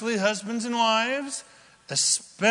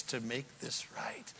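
preacher whispering the end of the vow; 6 semitones lower, 14 dB quieter than usual. Real, not acted. Dramatic drop.

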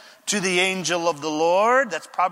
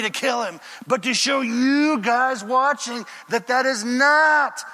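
David standing his ground, raising his voice; firm refusal; 4 semitones higher, slower than usual. Retold or imitated. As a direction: preach David's no with rising force. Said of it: "David said".

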